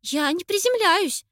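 breathless high voice; panting between words; out of breath. A narrator's reading. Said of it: "со сбивчивым дыханием".

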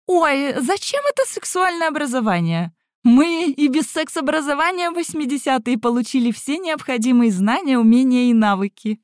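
put-on high, breezy older colleague's voice; Zinaida Mikhailovna, cheerily amused. A narrator's reading. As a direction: chirp it high, dismissing the idea gaily. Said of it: "бодро расхохоталась Зинаида Михайловна".

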